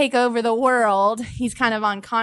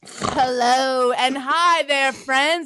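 stretched, over-sweet hello; weird podcast greeting; 4 semitones higher, much slower than usual. Shown as a demonstration. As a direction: greet them too sweetly. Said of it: "the hello, friends was weird".

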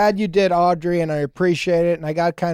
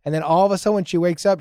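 usual speaking voice

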